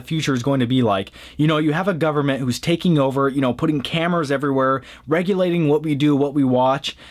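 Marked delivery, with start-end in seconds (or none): none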